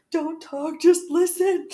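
high, tearful whimper of the killer; a light impression of a weepy killer. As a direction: imitate his weepy plea. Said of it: "he's known for, is the voice".

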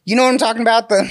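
laughing. Real, not acted. Laughing while asking.

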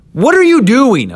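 high, loud incredulous yell; outraged Moses. Acted. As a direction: explode at your brother in disbelief. Moses confronting Aaron.

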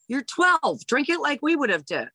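hammed-up tough-mom dare; mock tough mom. A mom jokingly scolds her daughter over the beer.

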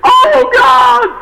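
very high, loud mock-panicked shout; desperate cry. Man dangling from cliff to God, desperate cry. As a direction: scream to heaven in hammed-up desperation. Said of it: "he cries out to God".

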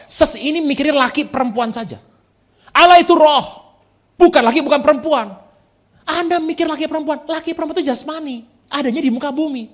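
loud, high proclaiming sermon voice; fiery pulpit declaration; preaching at full force. Acted. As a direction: hammer the truth home to the crowd. Preaching answer to the woman.

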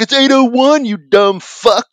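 high mock-exasperated kid correcting dad; indignant correction. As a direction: pitch it up, stretch out the insult. Son pedantically correcting his father.

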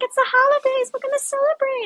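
very high-pitched party squeal; sing-song high celebratory voice; festive mock excitement. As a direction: chirp it like celebrating friends. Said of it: "'cause we're like".

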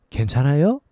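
괜찮아요 with the pitch rising at the end; question rise. Shown as a demonstration. Teacher showing the asking tune. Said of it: "raise it up like we do a question".

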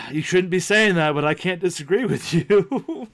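scold, then give in helplessly; reluctant scolding. Retold or imitated. Dad agreeing with cursing daughter, amused mock scolding.

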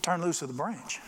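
soft, composed divine command; understated, calm voice from above; voice of God. Acted. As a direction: give the order quietly, without urgency. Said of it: "the voice of God said".

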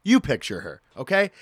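usual speaking voice

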